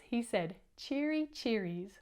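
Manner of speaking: ordinary delivery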